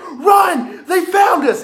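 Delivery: high, loud acted yell of alarm; urgent shout. Clown guide to group when discovered, urgent shout. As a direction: imitate a guide shouting for everyone to flee.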